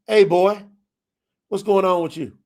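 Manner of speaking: lightly voiced dad calling out to his son; daddy's voice. Daddy answering the phone to his son, a touch hearty.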